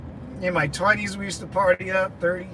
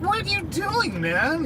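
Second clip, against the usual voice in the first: high, incredulous "what do you mean"; a jump in pitch, like you can't believe it; surprised disbelief; 11 semitones higher than usual. Retold or imitated. Retold disbelief at friend.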